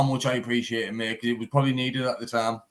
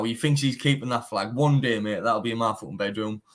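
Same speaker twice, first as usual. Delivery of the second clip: ordinary delivery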